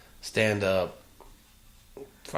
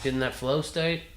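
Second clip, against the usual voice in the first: ordinary talk, nothing marked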